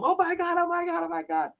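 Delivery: high-pitched panicky repetition; mock panic. Running out of the haunted room, comic panic. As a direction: squeal it like someone running for their life.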